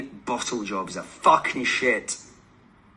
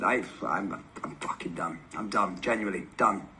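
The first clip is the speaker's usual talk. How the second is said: much softer and lower, worn out and resigned; defeated; 5 semitones lower, 6 dB quieter than usual. Real, not acted. Fan giving up on his team, quiet and defeated. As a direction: let the fight drain out of the voice.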